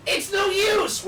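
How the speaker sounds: over-the-top yell of "no use"; bad acting. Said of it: "So I delivered it like".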